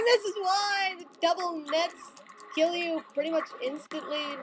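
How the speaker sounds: ordinary talk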